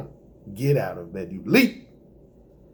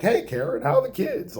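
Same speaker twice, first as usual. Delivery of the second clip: cheery greeting; 12 semitones higher than usual. Acted.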